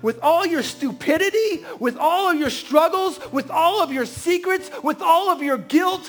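preach. Acted. Pastor pleading with the congregation, preaching at full force. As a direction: build each line like a rising altar call.

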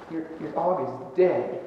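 low, soft and slow; halting, hushed retold bad news; hesitant bad news. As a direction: break it slowly and quietly, with a stumble. Breaking bad news to upset woman.

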